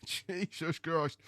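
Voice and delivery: soft, slow laughing exclamation; amused quiet Jesus Christ; laughing under breath. Real, not acted. Laughing exasperation.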